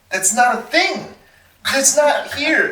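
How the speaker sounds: high, slow imitation of friends saying no; mock incredulous friends. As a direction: stretch out that's not here in high mock shock. Friends flatly refusing the idea.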